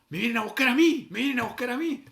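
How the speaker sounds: high, loud comic alarm; hammed-up excited outburst; mock panic. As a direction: blurt it out as the one being singled out. Retold nervous thought as heels approach.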